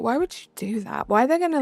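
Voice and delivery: ordinary talk, nothing marked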